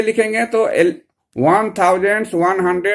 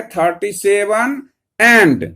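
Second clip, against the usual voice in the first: slow teaching read; much slower than usual. Shown as a demonstration. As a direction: drag out "thirty seven and" slowly. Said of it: "ऐसे करके लिखेंगे तो कैसे लिखेंगे".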